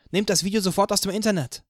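high, loud, fast outraged scream. A narrator's reading. Anna hears of the million-pound lawsuit and screams in outrage. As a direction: explode at the whole group in disbelief. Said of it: "schrie sie".

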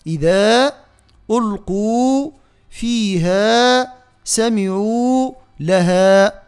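إذا ألقوا فيها سمعوا لها piece by piece, long vowels equal; drawn-out, evenly timed recitation of إذا ألقوا فيها; fully saturated vowels. Shown as a demonstration. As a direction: slowly separate each word and hold its ending. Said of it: "الكل مشبعين".

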